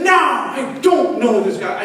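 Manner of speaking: acted, high-pitched indignant denial; cursing denial. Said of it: "He cursed and said".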